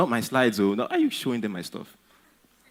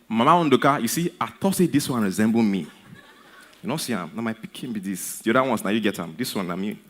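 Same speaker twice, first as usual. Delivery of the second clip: proud mother in Nigerian pidgin, louder at first; 4 dB louder than usual. Acted. Mother praising her clever son to a friend, in proud Nigerian pidgin. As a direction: brag about your son in Nigerian pidgin.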